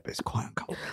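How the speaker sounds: breathy aside whisper. Real, not acted. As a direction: drop to a whisper.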